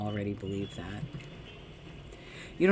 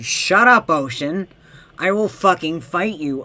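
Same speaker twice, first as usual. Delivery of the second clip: loud, high mock-furious shout; hammed-up threat, yelling; angry yell; 5 semitones higher, 10 dB louder than usual. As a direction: pick a fight with the sea. Yelling at the Ocean.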